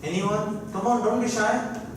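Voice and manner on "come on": ordinary delivery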